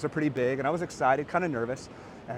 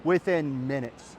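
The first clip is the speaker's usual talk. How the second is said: ordinary talk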